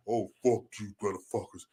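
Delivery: low, quiet mock insult; mock cursing, hushed. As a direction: curse them under your voice, low. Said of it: "We're not like".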